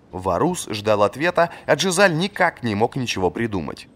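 usual speaking voice

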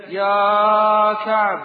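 long, high, drawn-out call; distant caller's shout. Acted. Crier hailing Kaab from afar, long loud call. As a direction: call out loudly as if across a distance. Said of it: "صوت من بعيد".

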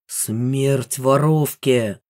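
low, slow hissing monster. Acted. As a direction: hiss it like a beast in the dark. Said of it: "прошипели мне в лицо".

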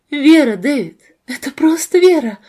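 loud, high emphatic appeal; impassioned wife arguing back; insistent. A narrator's reading. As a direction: insist on it with rising force.

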